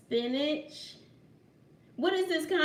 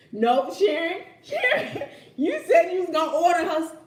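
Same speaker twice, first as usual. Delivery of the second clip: loud laughing call; 5 semitones higher, 10 dB louder than usual. Real, not acted. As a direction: call her name while cracking up. Laughing, calling to Sharon.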